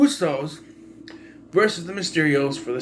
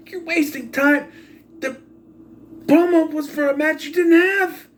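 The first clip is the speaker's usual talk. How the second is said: higher-pitched, heated questioning of the bookers; exasperated rant; 7 semitones higher than usual. Real, not acted. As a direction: vent your real frustration, pushing higher as it builds. Ranting at the show's booking.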